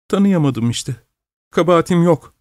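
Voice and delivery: understated weepy self-defence; tearful protest. A narrator's reading. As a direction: plead it with a touch of crying. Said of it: "diyerek ağlamaya devam ediyordu".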